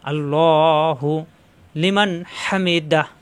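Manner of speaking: "আল্লাহু" stretched by one alif, slowly; slow recited "আল্লাহু"; long alif stretch. Shown as a demonstration. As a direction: draw out "আল্লাহু" and then "লিমান হামিদাহ" slowly. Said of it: "একালিপ টান".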